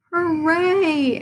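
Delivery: high group cheer. A narrator's reading. Everyone cheering the two presidents, high. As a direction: cheer the tie result. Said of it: "everyone cheered".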